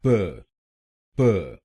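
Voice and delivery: प as a neutral "puh"; correct syllable. Shown as a demonstration. Teacher drilling the second syllable, correctly sounded. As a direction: say प with a schwa, no "o". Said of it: "दूसरे शब्दांश को बोलिए".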